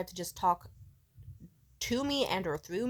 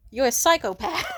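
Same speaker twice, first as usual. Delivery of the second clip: louder, higher, tipping into laughter; mock accusation that cracks you up; retold jab with a laugh; amused accusation; 8 semitones higher, 8 dB louder than usual.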